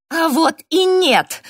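very high, loud, drawn-out squeal; piercing, defiant cry; shrieking. A narrator's reading. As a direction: shriek over everyone else's voices. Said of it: "взвизгнула я, заглушив все остальные голоса".

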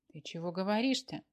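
ordinary delivery, nothing marked

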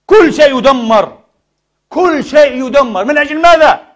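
high, loud and slow, building to a cry; preacher decrying the ruins; impassioned outcry. Real, not acted. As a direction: hammer each word in outrage. Preacher decrying Syria's destruction.

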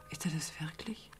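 a grieving whisper, barely voiced; whispered doubt. [name] mourning Harry, asking herself in a whisper. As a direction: breathe the question out to herself.

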